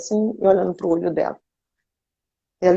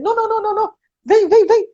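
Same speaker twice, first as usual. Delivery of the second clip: insistent priest; faster than usual. Retold or imitated. Priest insisting Zezé come to him, high and coaxing. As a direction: mimic the priest pleading, climbing in volume.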